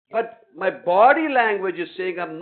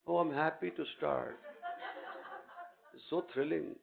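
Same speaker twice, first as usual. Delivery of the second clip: mock timid excitement; 4 semitones lower, 11 dB quieter than usual. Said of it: "mocking a timid answer".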